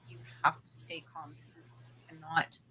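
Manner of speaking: soft, low urging; a quiet voice in the head; hushed inner voice. Retold or imitated. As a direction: murmur it as a voice inside. Voice in her head.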